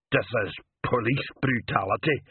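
usual speaking voice

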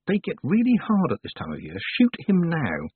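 high, insistent inner voice; urging. A narrator's reading. Tiffany's second thoughts pushing her to attack, urging. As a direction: press the point, slowing on the order. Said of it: "her second thoughts urged".

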